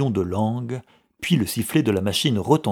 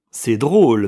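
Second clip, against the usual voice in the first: louder, scornful remark; disdainful; 3 semitones higher, 9 dB louder than usual. A narrator's reading. Younger brother doubting the clerk's affection, disdainful. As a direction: toss it out with contempt. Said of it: "murmura Joseph avec dédain".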